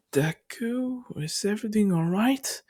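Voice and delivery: high-pitched worried question, imitated; concerned question. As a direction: ask in a high voice, rising at the end. Acting out a concerned character asking.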